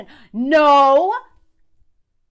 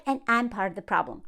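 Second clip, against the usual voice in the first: mocking him in a small, soft voice; a creator mimics his confession, soft and sheepish; mock meek quote; 8 semitones lower, 8 dB quieter than usual. Mock meek mumble. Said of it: "he's like".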